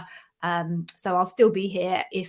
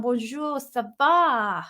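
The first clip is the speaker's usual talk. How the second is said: clumsy French accent; terrible French accent; 2 semitones higher, slower than usual. Real, not acted. British host greeting a viewer from Paris, bad French accent. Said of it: "that was terrible, s-, um, accent".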